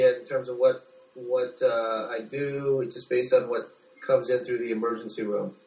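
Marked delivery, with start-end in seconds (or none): none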